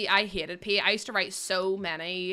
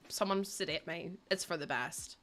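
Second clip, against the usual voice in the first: muttered aside; 5 semitones lower, 10 dB quieter than usual. Pretend. Mock despair aside.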